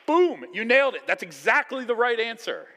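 high, loud, thrilled "you nailed it"; excited praise. Retold or imitated. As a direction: cheer the right answer like a proud teacher. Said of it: "Jesus is like".

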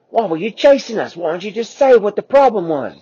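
indignant. Acted. The T-Rex mom indignantly asking Quasi why they ran. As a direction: demand an answer.

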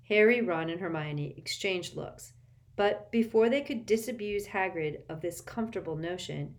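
ordinary delivery, nothing marked

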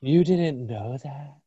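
slow, drawn-out mock incredulity; Oceana. Host redoes friend's reaction to the movie trivia, imitated drawn-out disbelief. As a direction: imitate her, stretching out the astonished question. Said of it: "sorry, she doesn't sound like that".

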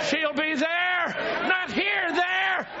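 normal talking voice

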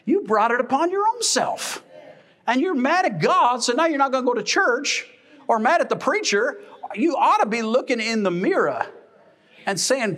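fired-up pulpit call; preaching at full force. Acted. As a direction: rise up and drive it home.

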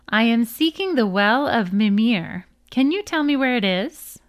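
ordinary delivery

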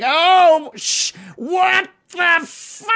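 high, drawn-out terrified yell; absolute horror. Acted. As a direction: scream it slowly as if facing your worst nightmare. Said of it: "to absolute horror".

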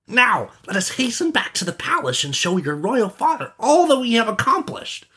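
put-on very high boyish character voice; excited young friend. Friend trying the charm himself, high and excited. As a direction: pitch it right up, wishing aloud.

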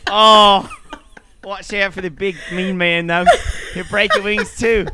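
hammed-up Australian seagull complaining. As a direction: warn a mate like a broken-winged Aussie seagull. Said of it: "These are what seagulls sound like".

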